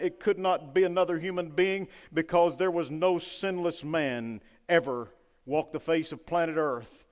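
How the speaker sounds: loud, driving preacher's cadence; fiery preaching. Acted. Preacher to his congregation, preaching at full force.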